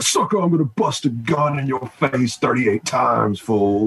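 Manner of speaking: a hint of a cartoon thug boasting; cartoonish tough rapper. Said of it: "cartoonish, you know, levels of violence".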